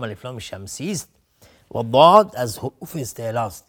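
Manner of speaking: ordinary talk, nothing marked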